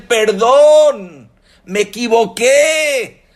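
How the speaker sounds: very high, loud preaching cry; impassioned appeal. Acted. Rabbis urging the woman to imagine begging God's pardon, impassioned cry. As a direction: plead at full voice with drawn-out words.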